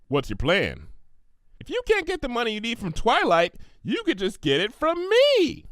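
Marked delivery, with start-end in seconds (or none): 1.6-5.7 high, bubbly pony voice; Pinkie Pie voice. Acted. Pinkie offering Applejack the money, high and eager. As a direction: chirp it out eager and helpful.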